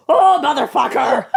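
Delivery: put-on laughing, loud old man curse. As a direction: spit it out like a grumpy old man. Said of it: "in an old man voice".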